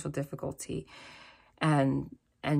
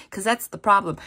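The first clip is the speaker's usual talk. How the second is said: normal talking voice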